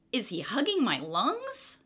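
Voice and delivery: usual speaking voice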